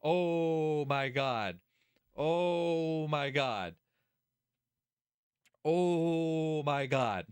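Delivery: very slow, long stretched first vowel; appalled groan. Real, not acted. Streamer appalled by the character's thoughts, long groaning disbelief. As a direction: drag it out in exasperated disbelief.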